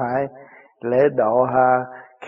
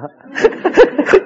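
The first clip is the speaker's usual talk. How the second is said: high laugh; 6 dB louder than usual. Real, not acted. Laughing at the joke.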